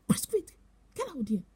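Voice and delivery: imitated urgent warning. Bishop to woman standing mid-street, urgent imitated warning. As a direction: imitate urgently ordering someone across. Bishop's voice warns of oncoming car.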